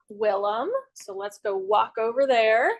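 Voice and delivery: ordinary delivery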